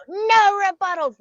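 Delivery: hammed-up yell cutting her off; group scream. Girls in the circle silencing the new girl, mock group scream. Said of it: "the whole group would just scream it all at once".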